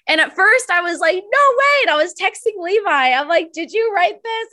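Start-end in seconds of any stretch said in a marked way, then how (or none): 1.2-1.8 high, louder, stunned no way; can't believe it; disbelief. Retold or imitated. Disbelief at writing. 3.5-4.5 higher, stunned questioning; shocked question. Retold or imitated. Texting roommate, shocked.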